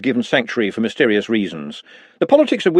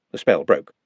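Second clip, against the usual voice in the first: plain reading voice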